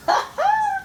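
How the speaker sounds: squealing happy giggle; high giddy giggle. Real, not acted. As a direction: giggle with delight. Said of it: "I get a little happy".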